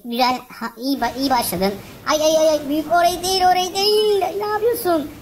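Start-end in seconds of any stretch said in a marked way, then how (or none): 2.1-5.1 alarmed cry. Real, not acted. Watching his brawler go the wrong way, alarmed and shouting. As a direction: shriek at the brawler going the wrong way. Panics at character's wrong move.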